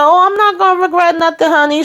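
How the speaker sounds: high flirty honey; high sassy dismissal. Retold or imitated. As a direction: voice lifted high, breezy and sure. Priscilla brushing off regret.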